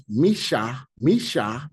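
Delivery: "Misha" as mi-SHA, ending on the vowel; open syllable. Shown as a demonstration. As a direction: sound out both vowels of "Misha". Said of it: "if we was to do open syllable".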